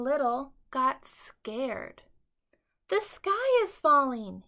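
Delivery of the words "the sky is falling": high, loud panicked chick; panicked cry. A narrator's reading. Scared chick crying out to himself. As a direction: yelp it in alarm. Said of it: "he thought".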